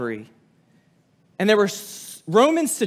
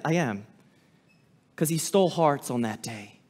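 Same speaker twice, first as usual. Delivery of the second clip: softer and lower, confiding; quiet aside to the congregation, lower; dropped low; 7 semitones lower, 6 dB quieter than usual. Real, not acted. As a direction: sink the voice down. Dropping voice for emphasis.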